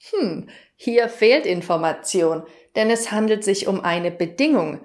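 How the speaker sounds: normal talking voice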